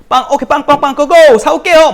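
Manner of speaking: put-on high, loud, cheery errand-boy yelp; mock eager gofer. Teen pastor to bullies, mock-cheery high yelp with a laugh. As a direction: play the overeager kid happy to fetch bread.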